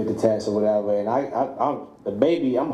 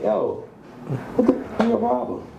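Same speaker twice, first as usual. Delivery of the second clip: higher, demanding yell at her; angry confrontation; 11 semitones higher than usual. Retold or imitated. Calling the baby's mother, riled up and demanding. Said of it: "I'm like".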